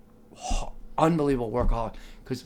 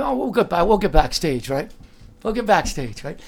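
eager, louder bragging to the date; excited boast; 5 semitones higher, 5 dB louder than usual. Retold or imitated. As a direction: puff it up, sure of getting backstage.

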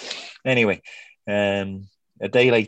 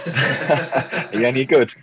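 laughing; 4 semitones higher, 4 dB louder, slower than usual. Real, not acted. Laughing at golf friend request.

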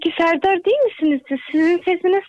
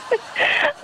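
giggle; 9 semitones higher, 4 dB quieter than usual. Real, not acted. Caller laughs at mother.

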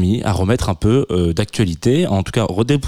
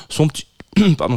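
frog in throat; 8 semitones higher, faster than usual. Real, not acted. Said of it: "j'ai un truc dans la gorge".

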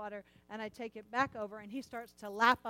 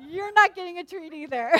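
laughing refusal; 7 semitones higher, 13 dB louder than usual. Retold or imitated.

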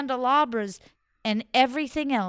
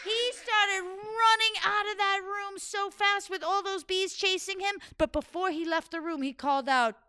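plain reading voice

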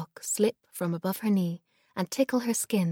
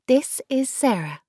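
higher, louder proud introduction; proudly; 7 semitones higher, 6 dB louder than usual. Acted. Girl introducing her friend to grandma, proudly. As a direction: present your friend with pride. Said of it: "Lila said proudly".

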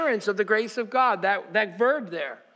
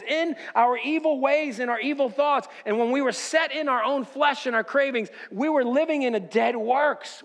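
higher-pitched, driving sermon delivery; impassioned preaching; 4 semitones higher than usual. Real, not acted. Preaches at full force.

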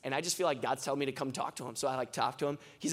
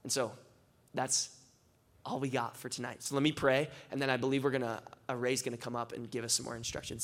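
ordinary talk, nothing marked

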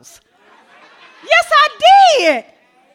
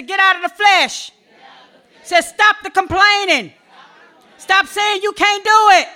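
loud, commanding declarations; preaching call-and-response; 5 semitones higher, 7 dB louder than usual. Acted. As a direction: lead the church to repeat after you. Leads congregation in declarations.

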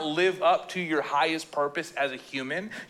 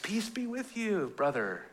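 laid-back hippie; 2 semitones higher, 7 dB quieter than usual. Retold or imitated. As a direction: drift it out like a seventies hippie. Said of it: "It's like Jesus Revolution... they're all hippies".

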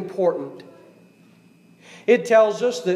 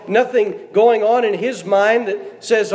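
ordinary talk, nothing marked

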